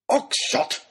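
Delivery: high warning cry; fed-up lordly rebuke; exasperated scolding. Acted. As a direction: call his name in outrage. Lord scolds the baronet.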